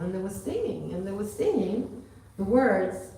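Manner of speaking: ordinary talk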